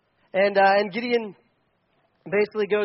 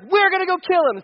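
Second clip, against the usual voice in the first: angry mob; 10 semitones higher, 5 dB louder than usual. Retold or imitated. Angry mob wants Gideon dead.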